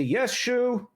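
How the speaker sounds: high, dutiful crowd voice; a hint of townsfolk chorus; townsfolk reply. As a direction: answer the elder obediently. Townsfolk answer in unison.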